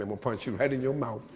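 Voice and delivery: "mouth" said mouf with an f; quick, end "mouth" on an f. Shown as a demonstration. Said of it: "You'll hear it like an F".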